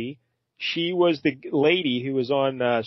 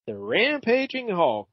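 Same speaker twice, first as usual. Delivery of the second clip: high, drawn-out RAMPAGING; dramatic title read; 8 semitones higher, slower than usual. Acted. Host reads out the comic's cover logo, dramatic title read. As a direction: stretch the title out with showy relish.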